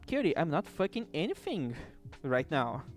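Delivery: normal talking voice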